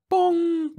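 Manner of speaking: loud, high ringing imitated gong; machine bong. A narrator's reading. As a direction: sound a high alarm chime with the voice. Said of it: "went the Monitex".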